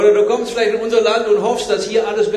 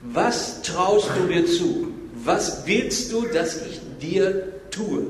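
softly weighted, word by word; slow, deliberate question; 2 semitones lower, 5 dB quieter, slower than usual. Retold or imitated. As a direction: ask it very slowly, leaving room for an answer. Jesus's question voiced with weight.

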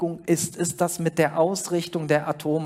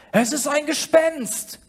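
higher, slow cry of horror; terrified cry; 9 semitones higher, 3 dB louder, slower than usual. A narrator's reading. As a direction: cry out in terror at a ghost. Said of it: "sie schrien voller Entsetzen". (erschrockener Ausruf, höher)

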